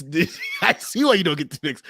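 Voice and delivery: laughing. Real, not acted. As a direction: laugh at being rattled. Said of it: "I'm rattled".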